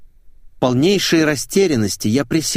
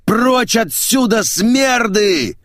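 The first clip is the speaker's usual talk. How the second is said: loud, high, slow roar; 11 semitones higher, 3 dB louder, much slower than usual. Acted. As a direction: roar like a woken bear. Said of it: "заревел, как разбуженный медведь".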